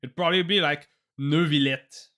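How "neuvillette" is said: drawn-out French-accented Neuvillette; attempted French accent, slow "Neu-vi-llette". Shown as a demonstration. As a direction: say Neuvillette as French as you can. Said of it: "French accent I could do".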